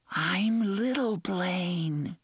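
put-on high, very slow child whisper; a hidden frightened child whispering; eerie ghost child. A narrator's reading. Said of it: "the child's voice whispered".

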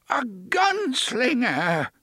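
high, slow, wavering excited cry; excited, cracking voice. A narrator's reading. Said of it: "His voice cracked and wavered with excitement".

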